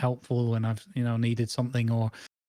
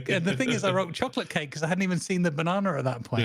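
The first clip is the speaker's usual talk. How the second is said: ordinary talk, nothing marked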